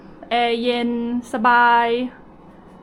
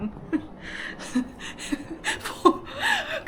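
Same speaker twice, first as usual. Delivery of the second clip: high breathless laugh; 5 dB quieter than usual. Real, not acted. Laughs at her own loudness.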